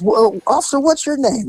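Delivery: high, sweet question; mother asking the officer his name, high and sweet; lightly voiced mother. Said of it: "she goes".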